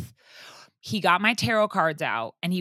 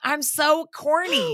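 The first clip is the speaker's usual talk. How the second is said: drawn-out, higher self-mocking whine; a slow, pitched-up self-mocking whine; mock cringe; 7 semitones higher, 4 dB louder, slower than usual. Real, not acted. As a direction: groan at yourself, slowly and high. Cringing at her own quote.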